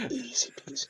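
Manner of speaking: soft high smug whisper; very soft smug quip; smug hush. Acted. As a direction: breathe it out, cocky. Shadow-style quip.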